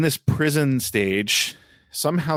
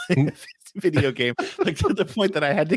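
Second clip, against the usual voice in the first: laughing; 7 semitones higher than usual. Real, not acted. Laughs at own discomfort.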